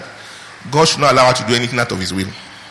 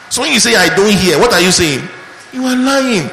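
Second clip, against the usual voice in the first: higher and louder, building to an accusation; preaching fervor; 8 semitones higher, 4 dB louder than usual. Real, not acted. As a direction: call them out from the pulpit. Preaching at full force.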